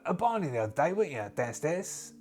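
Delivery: teasing, prying character voice starting high; lightly acted knowing geezer; lightly voiced matey enforcer. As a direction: nudge them to own up. Character needles brothers about a fight.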